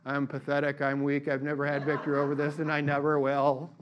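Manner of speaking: low, soft, monotone echo; hypnotized, obedient drone; mind-tricked victim. Acted. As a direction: repeat it like you're under a mind trick. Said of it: "The Jedi mind trick".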